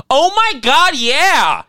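very high, loud mock delight; crowd cheering. Fans gushing at the joke, high and excited. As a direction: squeal it like a thrilled fan. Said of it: "everyone's like".

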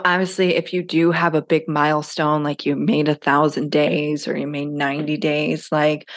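ordinary talk, nothing marked

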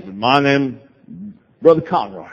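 low-pitched, lightly put-on Texas preacher; mock Texan accent. Texas evangelist naming himself to a stranger, mock Southern accent. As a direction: introduce yourself in a rough Southern accent. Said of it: "Forgive my poor Southern accent".

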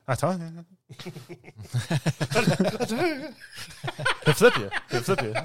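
high garbled mumble, breaking into laughs; comic high-pitched mush-mouth; mumbled character impression. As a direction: imitate a barely intelligible mumbler. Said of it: "trying to talk like that".

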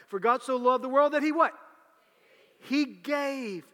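For God so loved the world that he what, pitched high and loud; loud call-and-response. Shown as a demonstration. Preacher prompting his congregation, loud call-and-response. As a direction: prompt the church to shout the answer. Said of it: "asks congregation to finish".

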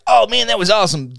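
high, loud gushing exclamation; excited fan. Retold or imitated. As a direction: gush like a thrilled fan. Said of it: "favorite person like".

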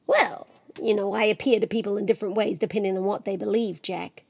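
hushed, confiding aside; quiet, cagey Death; knowing Death. Acted. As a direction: explain it softly and evasively. Death explains himself to Jack.

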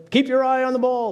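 mock yell, pitched well up; imitating a yelling dad. Dad yelling at his son who struck out, in a mock yell. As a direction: call it out like a frustrated dad in the stands. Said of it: "Dad yells at him".

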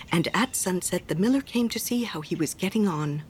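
plain reading voice

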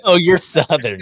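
high, loud teasing parrot; mocking imitation. Cohost mimicking a buddy's dig at the Southerner, high and loud. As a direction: mimic your buddy to needle him. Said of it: "he said".

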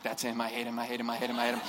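low, hushed, repeated grumbling; muttered grudge. Acted. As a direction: mutter it under your breath like a seething classmate. Acted grudge against top student.

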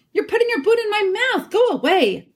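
very high, rapid, indignant complaint; cross yelping, pitched far up; bellowing. A narrator's reading. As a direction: protest in a hurry, squawking. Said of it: "bellowed Trumpkin".

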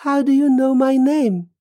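high-pitched, startled question. Acted. Man hears a ghost say his name, startled. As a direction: jump up in pitch with alarm.

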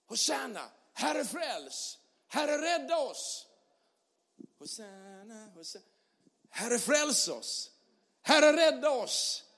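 slow pleading call, hushed at the middle; prayer cry. Acted. Pastor leads the church in a slow pleading prayer. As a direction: cry out to the Lord, then near silent.

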